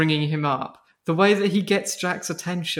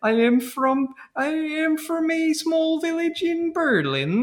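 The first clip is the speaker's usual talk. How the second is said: higher, slow mock German accent; terrible European accent; 8 semitones higher, slower than usual. Podcaster mimicking fake photographer from Berlin, terrible European accent. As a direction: stretch out a badly faked European accent. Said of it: "His accent is like".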